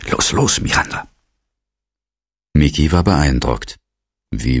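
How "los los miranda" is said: high-pitched, flustered urging; flustered, higher voice; flustered director. A narrator's reading. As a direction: hurry someone along in a fluster. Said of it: "stammelte der Direktor".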